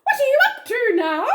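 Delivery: high, loud monkey voice; squeaky monkey. Acted. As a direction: call out as a cheeky storybook monkey. Monkey questions the crocodile.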